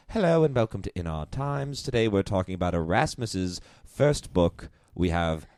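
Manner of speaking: mock highbrow British radio presenter; old people's podcast host. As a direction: introduce a learned discussion programme, hammed-up. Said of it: "I listen to a lot of old people fodder".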